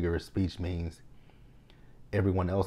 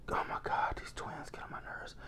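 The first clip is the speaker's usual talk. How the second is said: whispered, much softer, fed up; exasperated whisper; 11 dB quieter than usual. Real, not acted. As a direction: breathe it out like a fed-up aside. Irritated by the twins.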